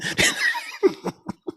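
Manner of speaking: a long, high, delighted laugh; high laughter. Real, not acted. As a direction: laugh freely at your own joke. Laughing at being cute.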